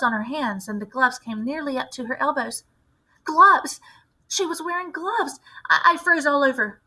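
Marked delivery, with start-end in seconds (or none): 3.2-6.8 sudden high cry of alarm on the first word; alarmed realization. Acted. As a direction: spot the danger and gasp it out. Boy realizes she's a witch.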